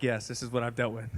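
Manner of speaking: low, soft, resigned yes; weary deadpan. Retold or imitated. Wife answers flatly, worn out and resigned. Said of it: "She's like".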